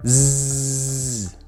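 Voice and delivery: Z held long and buzzing. Shown as a demonstration. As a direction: buzz out a long Z. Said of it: "say Z".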